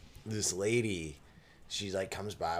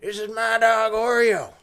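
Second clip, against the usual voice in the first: a higher, louder hammed-up proud grandpa; 12 semitones higher, 12 dB louder than usual. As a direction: boast about the dog like a beaming old man. Voicing a grandpa in a story.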